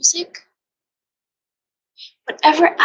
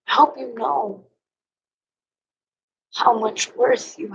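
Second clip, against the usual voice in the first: real tearfulness. Real, not acted. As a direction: hold back real crying between phrases. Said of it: "Sorry, pausing for more crying".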